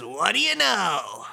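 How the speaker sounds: slow, swooping high in the middle; hammy villain surprise. Acted. Suzukichi reacting to the news, hammy. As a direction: draw it out theatrically. Voicing Suzukichi, then repeated plainly.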